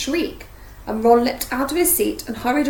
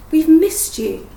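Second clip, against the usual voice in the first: higher, drawn-out, emotional; a slow, tremulous plea; 6 semitones higher, 7 dB louder, slower than usual. A narrator's reading. Hermione to Hagrid, tremulous. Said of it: "said Hermione tremulously".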